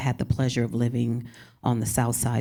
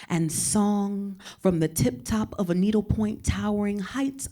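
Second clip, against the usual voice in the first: higher, measured spoken-word reading; poet voice; 5 semitones higher than usual. Shown as a demonstration. Reading her poem about San Antonio in her poet voice. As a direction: lift each line like a prayer. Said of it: "I will switch into poet voice".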